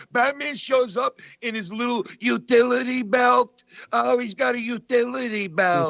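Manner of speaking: high, mocking comic voice; imitating Dice. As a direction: sneer at the utility belt like Dice. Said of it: "he's like".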